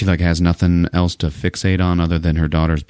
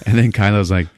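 ordinary talk, nothing marked

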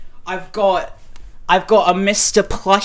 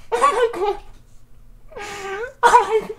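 high squeal. Real, not acted. Overwhelmed fan squealing.

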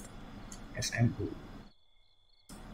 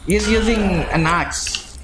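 being chased; 6 semitones higher than usual. Real, not acted. Chased by axe-wielding ghost.

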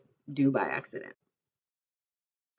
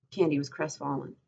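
ordinary delivery

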